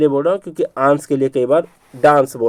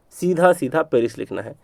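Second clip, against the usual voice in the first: ordinary talk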